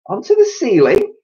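high, loud incredulous chirp; small bird's cry. A narrator's reading. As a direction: squeak the question in disbelief. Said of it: "cried one of the small birds".

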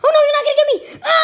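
high, loud frantic shriek; panicked defiance. Acted. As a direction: scream as the pursuer closes in. Mario being chased.

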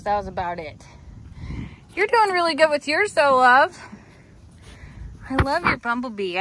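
normal talking voice

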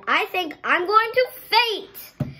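high dramatic cry of despair; mother hamming up a swoon; overreacting mother. Mother overreacting to the car smell, dramatic high wail. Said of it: "overreacting, said".

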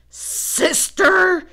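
loud high shout at his sister. A narrator's reading. Grumpy brother yelling at sister over her feet, loud shout. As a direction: yell her name angrily. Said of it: "he shouted".

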